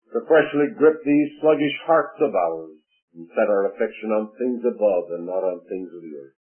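soft, fading pulpit prayer; closing prayer of the service, hushed. Acted. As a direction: pray it low and reverent, letting it die away.

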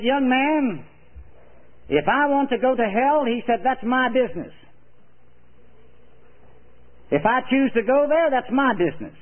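put-on a stubborn old man brushing the preacher off; old man refusing the preacher, gruff and defiant. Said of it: "in a rather gruff voice".

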